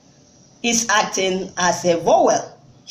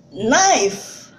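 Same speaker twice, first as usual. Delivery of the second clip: ordinary delivery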